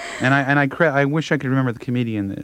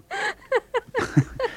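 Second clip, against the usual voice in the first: high cackle; 5 dB quieter than usual. Real, not acted. Laughing at own bit.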